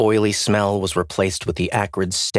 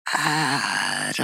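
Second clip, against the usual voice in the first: drawn-out, higher moan of a name; groaning; 5 semitones higher, 3 dB quieter than usual. A narrator's reading. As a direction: groan it out weakly. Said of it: "she groaned".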